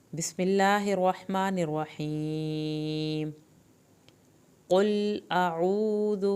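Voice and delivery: slow, chanted recitation of the opening; Quranic recitation. Shown as a demonstration. Teacher starts Surah Nas for her students, slow chanted recitation. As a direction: draw every syllable out, melodic and even. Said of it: "قاف کو پر کریں".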